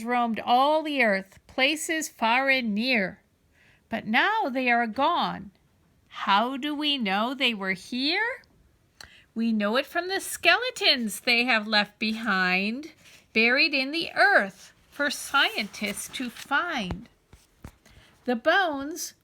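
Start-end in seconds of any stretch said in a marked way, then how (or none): none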